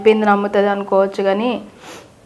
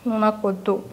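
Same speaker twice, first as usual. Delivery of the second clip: soft, withdrawn refusal; quiet rejection; 2 semitones lower, 8 dB quieter than usual. Real, not acted. Girlfriend tells boyfriend she doesn't want him, quietly.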